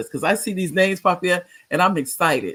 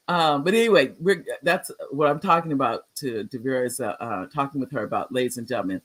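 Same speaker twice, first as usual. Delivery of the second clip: ordinary delivery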